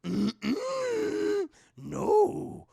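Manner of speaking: tease, then lay down the condition; Yoda voice. Retold or imitated. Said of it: "He's like".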